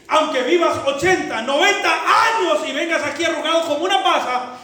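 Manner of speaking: loud, high, slow solemn warning; voice of God. Acted. God warning the unreconciled, loud solemn voice. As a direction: thunder it as God calling to account. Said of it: "y el Espíritu Santo repetirle".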